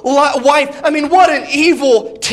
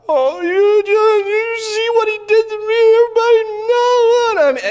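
mock crying; 10 semitones higher than usual. Ahab cries to his wife about Elijah, hammed-up whiny wail. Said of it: "He cries to his wife".

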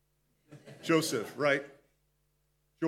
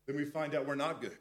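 usual speaking voice

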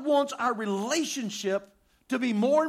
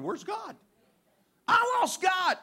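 mock panic; 10 semitones higher than usual.

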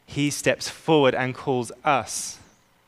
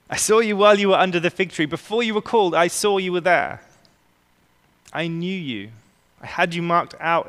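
higher, quicker, lively retelling of Jesus' words; voicing Jesus; 5 semitones higher, 3 dB louder than usual. Jesus telling Nathanael he saw him, lively and quick. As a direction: speak as Jesus, eager and knowing.

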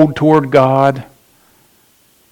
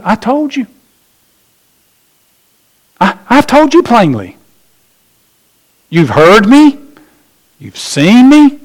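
exasperated Jesus; 8 semitones higher than usual. Retold or imitated.

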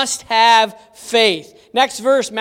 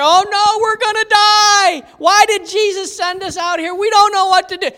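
high, loud panicked cries; frantic fishermen yelling in a storm; panicking disciples; 8 semitones higher, 6 dB louder than usual. Retold or imitated. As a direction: cry out in terror of the storm.

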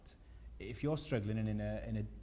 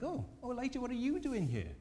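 much higher, hammed-up gasp of surprise; mock shocked God; 13 semitones higher than usual. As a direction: play God as a startled busybody caught off guard. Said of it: "He's not like".

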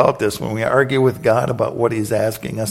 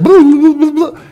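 high loud blah blah; mock chatter; 9 dB louder than usual. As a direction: rattle off mocking chatter. Mocking Moses' chatter.

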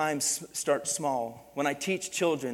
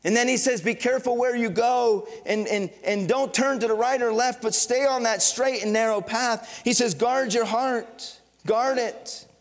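loud, rising preacher's urging; impassioned pulpit delivery; preaching at full force; 9 semitones higher, 9 dB louder than usual. Acted. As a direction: drive the warning home to the church.

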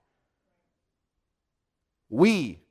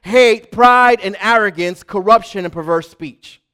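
loud, high preaching from the pulpit; preacher's fervent loud sermon voice; full-force preaching; 8 semitones higher, 13 dB louder than usual. Acted. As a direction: thunder it at the congregation.

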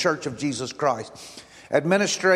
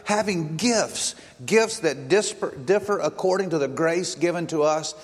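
ordinary talk, nothing marked

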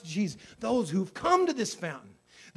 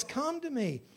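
tender invitation; 6 semitones higher, 5 dB quieter than usual. Acted. Christ invites gently.

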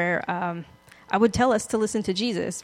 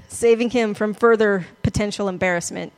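ordinary talk, nothing marked

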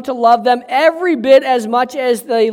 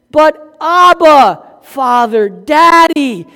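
fervent preaching; 5 semitones higher, 7 dB louder, slower than usual. Acted. Preaching climax.

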